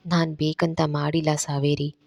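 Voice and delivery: quiet tender denial, holding her hands; soft and gentle. A narrator's reading. Randhir gently telling Saveri he didn't mean it, soft. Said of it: "ಮೃದುವಾಗಿ ಕೇಳ್ತಾನೆ".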